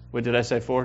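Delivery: normal talking voice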